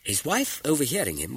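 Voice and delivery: ordinary delivery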